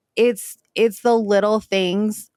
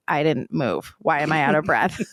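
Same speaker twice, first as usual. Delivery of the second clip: panting confused; 6 semitones lower than usual. Retold or imitated. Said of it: "I sound like my Frenchie".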